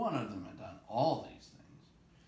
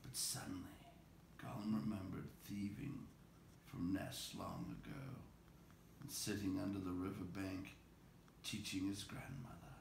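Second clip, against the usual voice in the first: whispered, softer storytelling; hushed narration; 9 dB quieter than usual. A narrator's reading. Narrator to listeners, dropping to a whisper. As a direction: lean in and whisper the tale.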